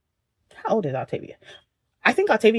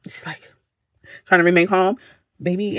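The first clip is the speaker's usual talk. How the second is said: normal talking voice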